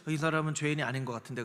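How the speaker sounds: a hint of an unbothered judge; mild musing judge. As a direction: muse it like a calm judge.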